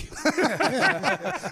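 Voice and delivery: loud, high burst of laughter; real laugh. Real, not acted. Comedian admitting some jokes are bad, real laughing. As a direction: crack up out loud at your own remark.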